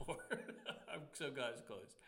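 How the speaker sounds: amused, laughing through the line; laughing relief. Real, not acted. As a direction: chuckle breaking into the words. Laughing about people once dated.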